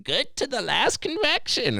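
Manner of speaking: very high, slow put-on voice; old cafeteria lady. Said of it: "fucking old lady from the cafeteria".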